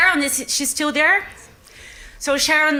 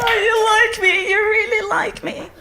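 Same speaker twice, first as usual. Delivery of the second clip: mock gushing thanks; 9 semitones higher than usual. Mock acceptance speech gush.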